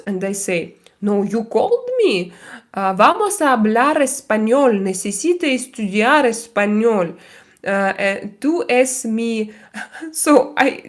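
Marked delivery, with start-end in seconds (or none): 1.0-9.5 put-on broken Spanish in a heavy Slavic accent. Insisting to the scammer on practising Spanish in a Slavic accent. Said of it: "with horrible Slavic accent".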